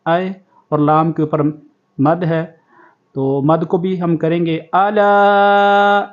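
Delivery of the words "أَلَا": أَلَا with the aa after the lam drawn long, slow; long alaa. Shown as a demonstration. Teacher shows how long to stretch the lam in أَلَا. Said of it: "اتنی لمبی کریں گے لام۔".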